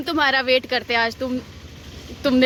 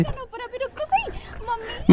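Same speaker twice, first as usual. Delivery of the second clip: panicky cry; 8 semitones higher than usual. Real, not acted. Panics on the bike.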